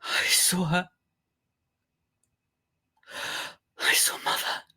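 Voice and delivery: hammed-up frightened ghost witness, mostly whispered; mock-fearful, halting confession with long pauses; pretend scared. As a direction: act terrified, dropping into a hushed whisper. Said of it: "please reenact you being scared".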